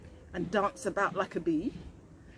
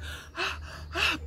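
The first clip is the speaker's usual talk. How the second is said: high puffing gasp; demo gasp; 9 semitones higher than usual. Shown as a demonstration. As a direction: show the children a breathless gasp. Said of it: "puffing like that".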